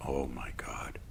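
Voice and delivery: breathless whisper of remembered wonder; awed whisper. Real, not acted. To the audience, recalling their first kiss, in an awed whisper. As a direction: hush it like sharing a dreamy secret.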